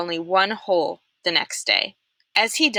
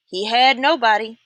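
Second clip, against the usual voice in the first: Pendanski voice; 4 semitones higher, 4 dB louder than usual. A narrator's reading. Pendanski dismisses Zero.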